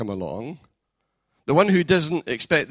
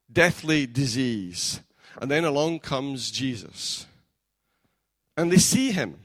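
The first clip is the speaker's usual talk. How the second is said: ordinary talk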